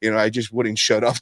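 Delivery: ordinary delivery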